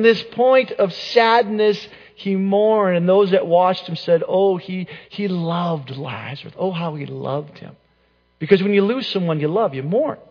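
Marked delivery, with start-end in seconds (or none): 4.3-7.8 imitated mourners' lament. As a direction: voice the onlookers' quiet grief. Mourners at Lazarus's death, voiced by preacher.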